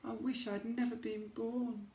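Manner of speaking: high, soft despairing horse; sad sigh. Acted. Lonely horse in the attic, softly despairing. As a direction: sigh it out, heartbroken. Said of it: "Sugarlump sighed".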